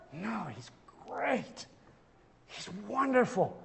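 soft, tender answer after the outburst; hushed awe. Acted. Preacher voicing restored Peter praising his Savior, hushed awe. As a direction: drop to a soft, reverent hush.

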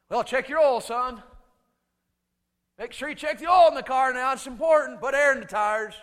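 loud, high, bluff dad; imitating a loud, blustering father; dad's brusque voice. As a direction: bellow car advice like an awkward dad. Dad deflecting an I love you.

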